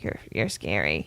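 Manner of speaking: low, hushed mock fright; spooked guy voice. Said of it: "he was like".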